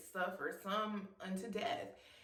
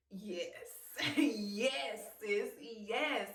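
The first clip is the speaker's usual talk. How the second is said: delighted self-affirmation; much slower than usual. Acted. Acted delight at her own reflection.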